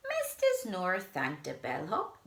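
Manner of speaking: usual speaking voice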